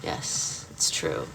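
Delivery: voiceless whisper, low and hushed; mock whisper. Streamer to chat, confiding a secret in a whisper. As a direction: hush it like a secret confession. Whispered aside about own expertise.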